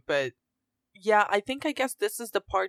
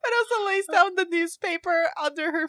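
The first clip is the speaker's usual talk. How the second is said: high, helpless laughing voice; real giggles pitching the voice up; talking through laughter; 10 semitones higher, 4 dB louder than usual. Real, not acted. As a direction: squeeze the words out while laughing. Cracking up at the scene.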